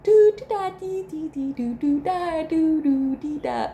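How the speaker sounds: put-on very high sing-song toddler babble; high baby nonsense sounds. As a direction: coo like a toddler playing in the snow. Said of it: "their child making sounds like".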